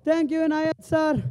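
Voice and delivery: usual speaking voice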